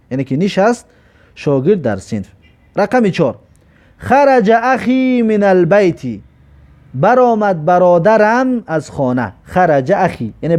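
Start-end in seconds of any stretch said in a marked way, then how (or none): 4.0-6.0 slow recitation. Shown as a demonstration. Teacher recites Arabic example.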